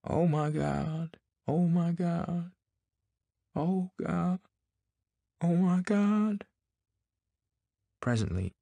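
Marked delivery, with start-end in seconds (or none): 0.0-6.5 high, slow, wailing moan; keen it out slowly, rising in grief; high horrible call. A narrator's reading. Husband wailing over his dead wife, slow high wail. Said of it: "his high, horrible call".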